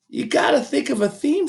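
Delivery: put-on an eager small girl asking; little girl. A narrator's reading. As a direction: Sweetie Pie asking about the theme.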